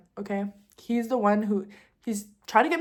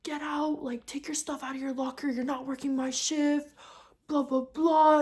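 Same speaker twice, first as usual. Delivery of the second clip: high-pitched imitation of a screaming manager; held-back mock yell, raised in pitch without real volume; 5 semitones higher, 3 dB quieter than usual. Manager throwing dancer out, imitated screaming. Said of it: "Yeah, screaming, screaming".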